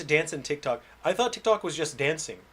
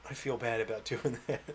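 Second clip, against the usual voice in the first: usual speaking voice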